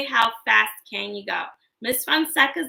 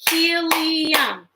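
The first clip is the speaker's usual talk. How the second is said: HELIUM chanted higher in three long beats; broken into syllables; 4 semitones higher than usual. Shown as a demonstration. Teacher asking children to clap along, Helium drawn out in syllables. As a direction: stretch Helium into he-li-um on a held higher note. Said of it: "Break words into parts".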